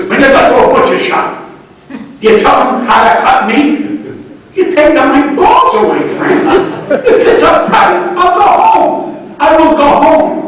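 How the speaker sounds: loud, heated defiance; indignant outburst. Retold or imitated. Comic defying [name] in the dressing room, loud and indignant. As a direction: stand up to him, loud and indignant.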